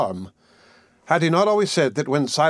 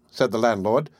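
ordinary delivery

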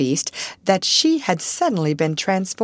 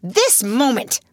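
higher, slow, fierce; fierce answer; 7 semitones higher, 3 dB louder, slower than usual. A narrator's reading. Miss Minchin to her sister, fierce. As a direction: snap the order. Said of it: "was the fierce answer".

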